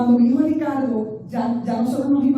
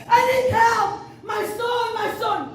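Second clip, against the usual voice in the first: súplica muy aguda, sin subir el volumen; reenacted high-pitched desperate cry for help; grito de auxilio retomado; 8 semitones higher than usual. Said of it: "empecé a gritar". (gritando por ayuda, en tono agudo)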